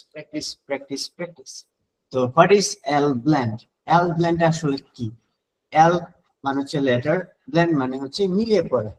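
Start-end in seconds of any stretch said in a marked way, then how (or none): 0.1-1.5 practice repeated, each softer till almost gone; fading repetition. Shown as a demonstration. Teacher playfully fading out the word practice. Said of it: "এখন practice". 2.1-5.1 slow, deliberate question. Real, not acted. Teacher slowly posing the question to students. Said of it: "poses a question to students".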